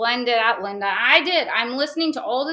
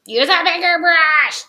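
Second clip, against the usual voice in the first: higher, louder, slow nagging chant; imitating bossy viewers, higher and slower; mock nagging; 6 semitones higher, 8 dB louder, slower than usual. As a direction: drag it out like a scolding chorus. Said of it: "That's what you guys sound like in my head".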